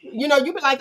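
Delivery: normal talking voice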